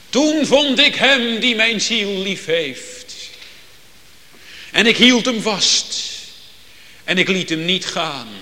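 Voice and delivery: luider voorgedragen, gedragen; vurig geciteerd. Retold or imitated. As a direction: citeer het Hooglied met gloed. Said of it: "dan zegt het Hooglied". (luid en gedragen citaat uit het Hooglied)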